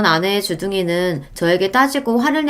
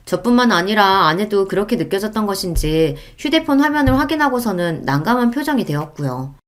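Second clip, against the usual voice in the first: plain reading voice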